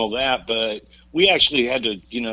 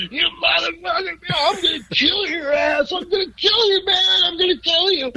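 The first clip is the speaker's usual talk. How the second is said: furious shout. Retold or imitated. Bandmate chasing him across the parking lot, screaming in fury. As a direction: scream it like you're chasing someone down to kill them.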